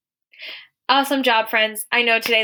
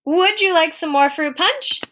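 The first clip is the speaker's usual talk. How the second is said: higher, louder question, lifted on "you"; question tune; 8 semitones higher, 5 dB louder than usual. Shown as a demonstration. Teacher reading the question aloud for students, questioning. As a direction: ask "Would you like" high and bright, as an offer.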